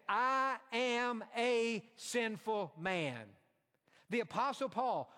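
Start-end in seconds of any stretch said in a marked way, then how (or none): 0.1-3.4 slow confession. Retold or imitated. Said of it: "He said".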